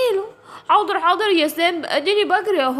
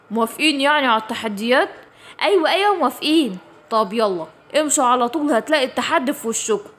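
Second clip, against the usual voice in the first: put-on lower, calm voice of a wise old creature; low old guardian; 4 semitones lower than usual. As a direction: speak as the kindly keeper of the tunnel. Guardian voice again. (صوت عجوز حكيم هادي)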